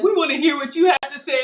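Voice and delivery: eager host. Retold or imitated. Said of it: "He was like".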